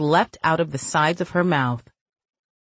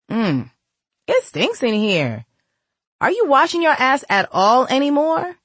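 teasing sister; 9 semitones higher, 3 dB louder than usual. Acted. Mia teases her sister.

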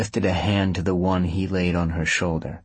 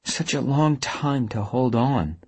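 higher, drawn-out musing; wistful murmur; 6 semitones higher than usual. A narrator's reading. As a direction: muse on it in a higher voice. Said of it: "Avery murmured".

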